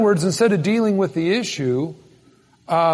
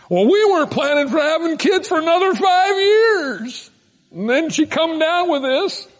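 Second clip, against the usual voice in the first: complaining husband; 10 semitones higher than usual. Retold or imitated. Husband protesting to the congregation in a high mock shout. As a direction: squawk it like a put-upon young husband.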